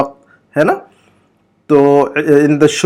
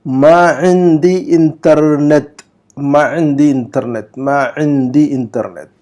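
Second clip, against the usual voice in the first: slow, syllable by syllable Arabic; slow model reading; slower than usual. Shown as a demonstration. Teacher reads the Arabic example slowly for students. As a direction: read it out slowly for students to copy. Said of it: "number دس کا جملہ پڑھیے".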